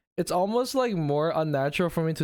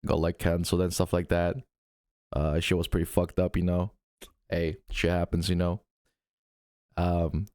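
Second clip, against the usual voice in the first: much lower, laid-back guy apologizing; apology video dude; 8 semitones lower than usual. Pretend. Pretend canceled streamer apologizing to fans, deep dude voice. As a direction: shrug it off in a deep bro voice. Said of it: "I make an apology video. I'm like".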